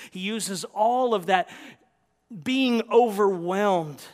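loud, mounting list; full-force preaching. Real, not acted. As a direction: pile it up with preacher intensity.

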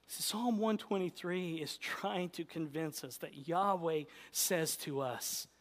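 usual speaking voice

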